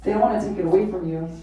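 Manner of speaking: ordinary delivery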